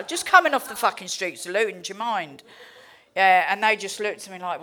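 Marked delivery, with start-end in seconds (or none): none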